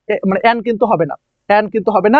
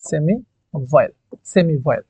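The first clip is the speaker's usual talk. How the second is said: slow, drawn-out term; 6 semitones lower, 5 dB quieter than usual. Shown as a demonstration. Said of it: "সেটা হচ্ছে তোমার semi ভয়েল".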